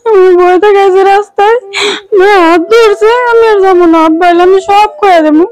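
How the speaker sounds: sobbing through the words; crying. Acted. Frightened daughter crying that she won't go to school. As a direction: plead while crying, voice breaking. Frightened girl tells father.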